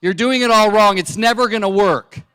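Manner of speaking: high, loud mock scolding; mock scolding wife. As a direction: bark the correction like a fussy wife. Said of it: "She goes".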